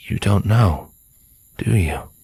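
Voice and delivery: hushed, halting realization; stunned question, falling to near whisper; low with disbelief. Acted. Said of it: "his voice low with disbelief".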